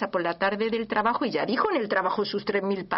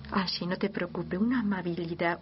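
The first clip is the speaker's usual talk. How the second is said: normal talking voice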